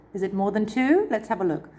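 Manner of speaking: usual speaking voice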